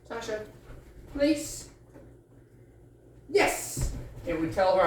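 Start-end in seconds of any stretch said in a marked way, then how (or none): 0.1-1.7 high pleading cue; dog trainer's high cue word; high command. Shown as a demonstration. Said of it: "yes would look like this". 3.3-3.9 high breathy marker yes; dog trainer's sharp yes marker. Shown as a demonstration. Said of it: "yes would look like this".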